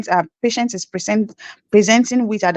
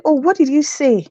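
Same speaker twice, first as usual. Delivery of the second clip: high puzzled voice quoting a listener; mock puzzled Canadian asking again; quoted Canadian; 6 semitones higher than usual. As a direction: ask it like a confused local.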